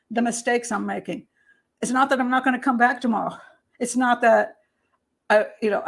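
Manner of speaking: ordinary talk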